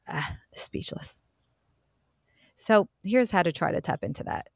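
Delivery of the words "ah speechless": breathy mock-stunned whisper, slowly; mock speechless whisper. Host teasing silent listeners in a stunned whisper. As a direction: whisper it as if the audience went silent. Listeners can't answer her question.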